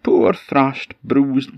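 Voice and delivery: loud, high drawn-out lament; hammed-up, self-pitying wail; woeful goat wail. Goat moans over its beating, woeful wail.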